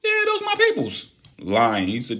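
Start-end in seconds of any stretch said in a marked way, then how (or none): 0.0-1.1 very high squeaky yeah; high-pitched fake confidence; nervous lie. Lying nervously.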